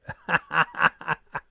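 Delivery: loud run of real laughs; laughter. Real, not acted. Streamer to shocked football viewer, loud laughter. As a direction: laugh out loud, in a quick string.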